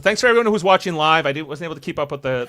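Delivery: ordinary delivery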